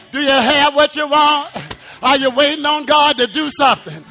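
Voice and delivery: ordinary delivery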